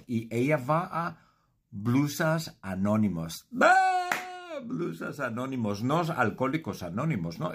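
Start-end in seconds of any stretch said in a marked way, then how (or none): 3.6-4.1 sudden high loud cry; mock gasp. Reacting to Blusas Anónimos, a loud high yelp.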